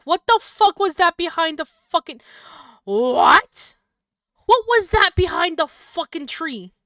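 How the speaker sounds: loud, high, panicked yelling at the screen; startled yelling. Real, not acted. Gamer yells at a jump scare, high and loud. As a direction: shout in real fright.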